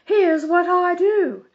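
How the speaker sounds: high, loud bossy lady's voice; fussy Mrs Armstrong. A narrator's reading. Busybody on the phone lecturing Mother, high and loud. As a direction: play the busybody organizer insisting.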